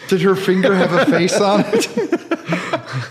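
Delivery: slow, high, laughing through the words. Real, not acted. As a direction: giggle it out slowly. Laughing at the gross joke.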